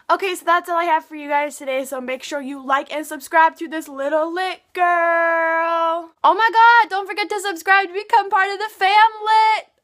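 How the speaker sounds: loud, high, fast outro; shouted outro. Acted. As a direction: yell the sign-off.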